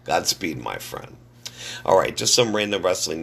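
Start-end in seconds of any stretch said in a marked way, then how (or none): none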